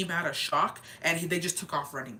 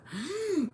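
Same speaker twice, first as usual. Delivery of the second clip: high, sharp gasp; 12 semitones higher than usual. Real, not acted. Reacting to the face of a rotting horse head, gasp. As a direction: gasp at the horror.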